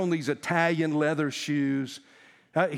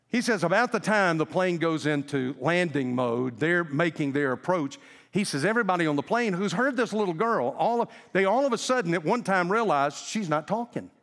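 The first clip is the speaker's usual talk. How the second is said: ordinary delivery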